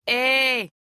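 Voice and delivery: loud hail across the water; calling out. A narrator's reading. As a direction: call up to the pavilion. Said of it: "called the woman up to the boat".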